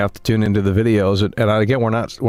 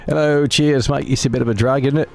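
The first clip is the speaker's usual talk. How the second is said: hammed-up British accent, a bit higher; mock a cheery British chatter in a put-on British accent; 5 semitones higher than usual. Streamer mocking his British viewers, in a mock British accent. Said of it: "why do you hate British people?".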